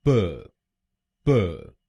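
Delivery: प as a short clipped syllable; प spoken as one short sound; syllable drill. Shown as a demonstration. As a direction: say प alone, short. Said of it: "पहले शब्दांश को यानी पीईआर हम बोलेंगे".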